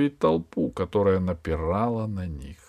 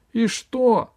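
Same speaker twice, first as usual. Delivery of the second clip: встревоженно; 12 semitones higher, 4 dB louder than usual. Acted. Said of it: "спросил встревоженно Гайдо".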